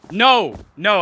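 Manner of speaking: loud, sudden no; emphatic denial. Real, not acted. Debater rejecting the other's claim, emphatic and loud. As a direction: burst out with a real objection. Flat denial mid-argument.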